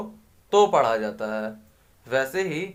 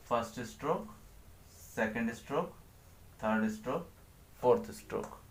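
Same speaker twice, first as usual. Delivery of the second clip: soft, low counting of each stroke; quiet stroke count; 3 semitones lower, 13 dB quieter, faster than usual. Shown as a demonstration. Teacher counts strokes softly while writing. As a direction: murmur the count while drawing.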